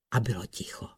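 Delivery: soft, low whispered hush. A narrator's reading. Narrator tells how the pond fell silent, hushed. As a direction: drop to a whisper. Silence falls after the splash.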